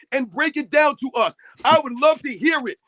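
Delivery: ordinary talk, nothing marked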